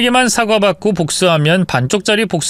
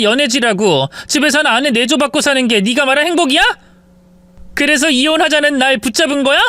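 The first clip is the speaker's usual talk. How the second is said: higher, rising outrage; bitter wife; 8 semitones higher than usual. Acted. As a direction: throw his words back at him. Wife berates cheating husband.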